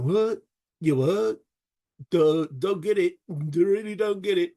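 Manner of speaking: baffled, dim-witted soldier voice; Hibbert. Acted. Soldier not getting Davis's call, baffled put-on voice. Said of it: "And Hibbert's going".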